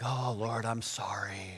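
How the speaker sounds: hushed, whispered sheepish prayer; sheepish apology. Acted. Doubter privately apologizing to the Lord, sheepish whisper. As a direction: apologize low under your breath.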